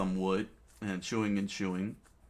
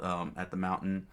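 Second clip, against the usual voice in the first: usual speaking voice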